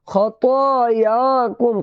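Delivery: खतायाकुम slowly chanted with long "aa" sounds; stretched vowels. Shown as a demonstration. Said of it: "खींच करके बोलेंगे". (खतायाकुम खींच करके)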